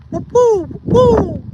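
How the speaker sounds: high hooting bird call, whoop woo; imitated bird call. Camper imitating the strange birds heard in the woods, high hooting call. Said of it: "it was coming from my right".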